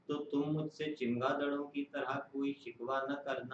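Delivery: measured, solemn poem reading; stately nazm recitation. Acted.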